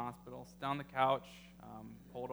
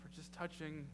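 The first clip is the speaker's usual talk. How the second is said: soft, earnest closing prayer; 3 dB quieter than usual. Acted. Pastor leading the church in prayer, soft and earnest. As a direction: pray quietly and humbly.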